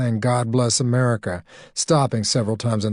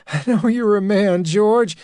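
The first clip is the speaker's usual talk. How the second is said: higher, louder nervous agreement; anxious placating; 10 semitones higher, 4 dB louder than usual. Acted. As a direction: reassure him out of fear. Connie placating, scared.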